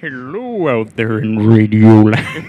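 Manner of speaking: loud, slow announcer's call; old-time radio voice. Pretend. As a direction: boom it out to radio listeners. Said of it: "the old time radio voice".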